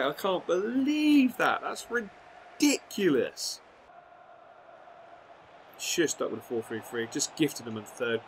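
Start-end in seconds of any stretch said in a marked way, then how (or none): 0.0-3.6 stunned disbelief. Real, not acted. Disbelief at third goal.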